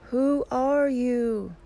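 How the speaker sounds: echo's high, drawn-out call; slow, loud answer ringing back from the mountain; echoed yell. A narrator's reading. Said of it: "He receives the answer".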